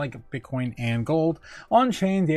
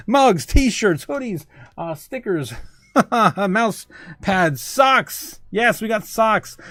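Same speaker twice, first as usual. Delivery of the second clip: excited plug; 5 semitones higher, 4 dB louder, much slower than usual. Real, not acted.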